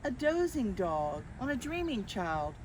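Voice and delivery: ordinary delivery, nothing marked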